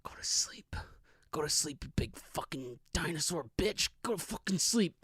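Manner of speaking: sinister bedtime whisper; hushed mock-menacing lullaby. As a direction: hiss it softly into the victim's ear. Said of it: "I'm whispering in its ear".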